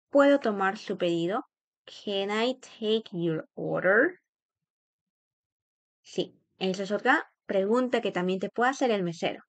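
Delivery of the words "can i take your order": slow question with "order" rising at the end; unhurried teaching repetition; slow model. Shown as a demonstration. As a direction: say it slowly for learners. Teacher repeats phrase slowly.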